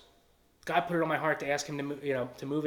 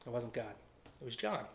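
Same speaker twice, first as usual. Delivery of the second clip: ordinary talk, nothing marked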